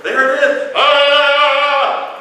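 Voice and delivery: loud, high sung healing cry; TV evangelist. Pretend. A TV evangelist healing an arm, high sung cry. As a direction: belt it out like a faith healer. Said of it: "He's like".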